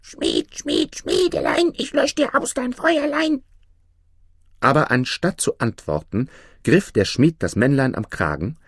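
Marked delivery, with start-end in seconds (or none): 0.0-3.4 very high, squeaky gnome voice; teasing chant in a tiny high voice; tiny man whispering. A narrator's reading. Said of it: "zum dritten Male erschien und wisperte". (wisperndes Männchen mit hoher Piepsstimme)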